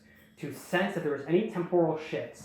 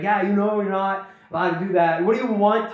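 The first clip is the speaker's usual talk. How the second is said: louder, slightly raised mock scolding; imitated berating; 3 semitones higher, 5 dB louder than usual. Toa still berating Nokama after the apology, imitated scolding. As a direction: keep up the telling-off with some force. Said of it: "berating Nokama".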